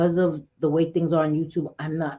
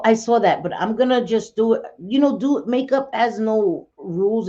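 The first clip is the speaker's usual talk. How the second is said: ordinary talk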